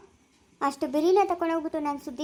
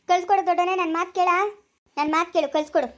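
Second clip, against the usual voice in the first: ordinary talk, nothing marked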